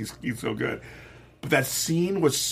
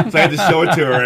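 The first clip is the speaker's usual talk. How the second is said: laughing; 5 semitones higher, 7 dB louder, faster than usual. Real, not acted. Laughing while retelling.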